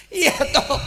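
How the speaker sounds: chuckling through the words; amused preacher laughing. Real, not acted. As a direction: laugh along with the audience.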